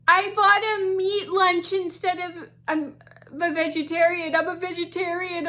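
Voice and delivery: a touch of a crying classmate; mock crying. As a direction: play the friend's tearful complaint, lightly. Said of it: "she starts kinda, like, crying".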